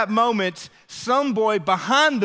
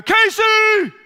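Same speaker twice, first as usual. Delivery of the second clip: much higher, loud shouted name; loud call; 10 dB louder than usual. Retold or imitated. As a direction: yell his name across the crowd to stop him. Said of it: "This loud, just deep voice scream".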